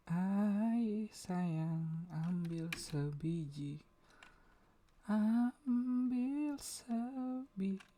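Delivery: high, slow sung woman's voice; man doing a woman's voice. Acted. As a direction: sing it like a woman, drawn out. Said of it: "aku pakai suara cewek".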